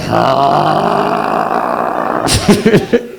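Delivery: imitated long breathy wail from the gut; stomach cry. Comic shows his audience a stomach cry, long mock wail. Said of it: "That stomach cry, you can't move".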